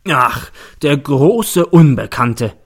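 langsam, lauter und höhnisch gedehnt; verhöhne die Ausrede genüsslich; spöttelnd. A narrator's reading. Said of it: "spöttelt der Staatsanwalt". (spöttelnd und gedehnt)

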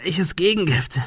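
pained, puzzled query. A narrator's reading. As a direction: ask through the pain. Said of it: "fragte er unter Schmerzen".

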